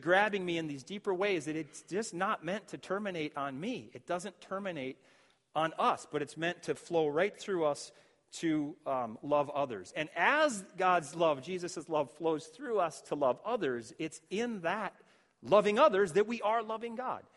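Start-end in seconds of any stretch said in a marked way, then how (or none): none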